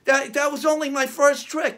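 normal talking voice

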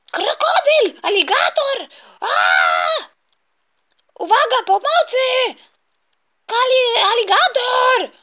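very high, loud shrieks; piercing mock-panic cries; terrified screaming. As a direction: scream for help at the crocodile. Kid screams at a crocodile.

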